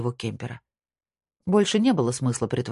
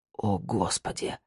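slow, low, soft groan; 5 semitones lower, 7 dB quieter, slower than usual. A narrator's reading. Cornered man hears his secret is known, groaning. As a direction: sink into a drawn-out groan of dismay. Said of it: "простонал Герлах".

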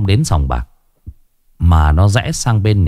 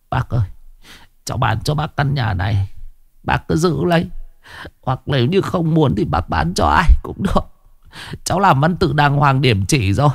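soft, high and humble; subdued young man; 6 semitones higher, 3 dB quieter than usual. A narrator's reading. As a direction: hand over the deed meekly. Tuất offering the house. (giọng khẩn khoản nhẹ nhàng)

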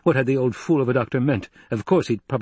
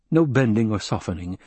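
plain reading voice

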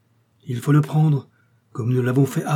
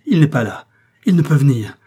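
loud, higher exclamation falling at the end; crying out; 4 semitones higher, 8 dB louder than usual. A narrator's reading. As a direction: call it out with force. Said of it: "s'écria-t-il". (s'exclamer haut et fort)

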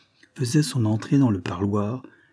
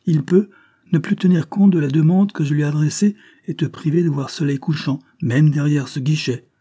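higher, louder menacing warning; a policeman intimidating a convict, raised voice; threatening; 6 semitones higher, 7 dB louder than usual. Acted. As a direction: frighten him with the threat. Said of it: "afin d'effrayer Langlade".

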